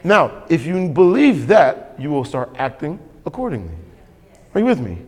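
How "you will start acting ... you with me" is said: ordinary delivery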